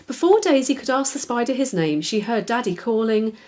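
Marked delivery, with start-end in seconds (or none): none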